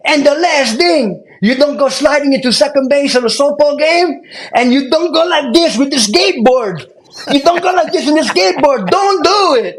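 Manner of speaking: put-on high, loud Cuban-accented drill instructor rant; Cuban instructor yelling. Cuban drill instructor screaming weekend warnings at recruits. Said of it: "We had this crazy accent".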